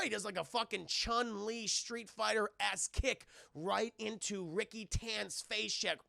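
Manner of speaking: ordinary delivery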